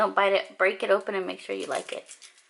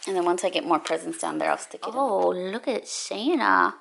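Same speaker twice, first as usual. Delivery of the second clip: ordinary delivery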